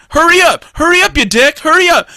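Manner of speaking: loud, high mock outburst; impatient yelling. Imitating inner rant at someone in line, impatient yelling. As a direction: snap it out at the slowpoke ahead.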